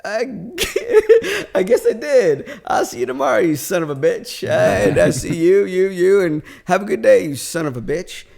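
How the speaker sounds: loud, high and gleeful retort; laughing taunt. Retold or imitated. Retelling his goodbye to the co-workers, loud laughing taunt. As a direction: crow it through laughter, drawing out the insults.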